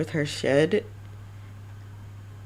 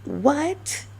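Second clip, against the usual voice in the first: high, rising double take; hammed-up confused what, pitched much higher; mock disbelief; 8 semitones higher than usual. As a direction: play a listener caught off guard. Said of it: "you'll be like".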